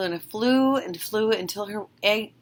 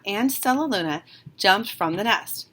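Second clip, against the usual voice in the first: ordinary delivery, nothing marked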